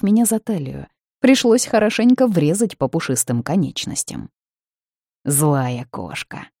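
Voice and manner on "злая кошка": slow, playful flirting; flirtatious teasing. Acted. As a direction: tease her, drawn out. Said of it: "заигрывая".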